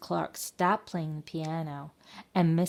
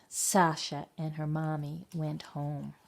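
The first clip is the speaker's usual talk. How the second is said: ordinary delivery, nothing marked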